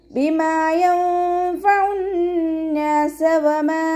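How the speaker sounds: slow, high melodic tajweed chant; chanted Quranic verse, drawn out; Quran recitation. Shown as a demonstration. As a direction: stretch each syllable in a ringing recitation.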